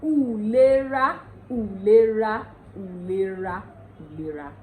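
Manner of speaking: "ulera" as oo-leh-ra, stepping down; word drill. Shown as a demonstration. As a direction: drill Ondo "ulera", fading with each repeat. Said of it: "Another word here is".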